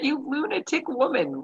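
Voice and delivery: lightly voiced bewildered agent; baffled stranger. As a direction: play the confused clerk. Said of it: "She's looking at me like".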